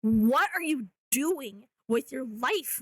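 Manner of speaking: usual speaking voice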